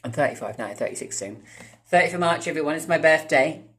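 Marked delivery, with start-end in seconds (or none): 1.9-3.6 higher, louder cheerful announcement; excited announcement. Real, not acted. Vlogger announcing his birthday to viewers, excited. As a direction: brighten up and tell everyone.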